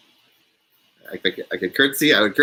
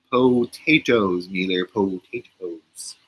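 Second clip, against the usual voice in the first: slow, lower hobbit impression; Samwise Gamgee; 6 semitones lower, slower than usual. Streamer doing a film impression for viewers, slow Samwise impression. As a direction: draw out the word like a hobbit. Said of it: "that was my Samwise Gamgee's impression".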